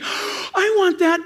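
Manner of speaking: gasped, high, drawn-out craving; gasp, then stretch it out with longing; hammed-up eager wish; urgent longing. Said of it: "There's a sense of urgency".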